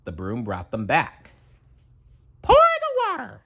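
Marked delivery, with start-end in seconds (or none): 2.4-3.4 high cartoon mouse voice giving an order; Mickey commanding. Mickey ordering the broom in his high mouse voice. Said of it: "said Mickey".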